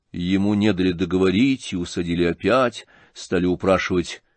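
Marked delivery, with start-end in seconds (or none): none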